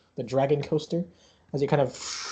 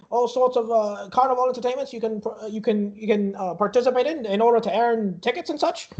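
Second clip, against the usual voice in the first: higher-pitched showman's patter; carnival barker; 7 semitones higher than usual. Acted. Barker telling little one about toys and rides, showy. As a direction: reel off the attractions to a child.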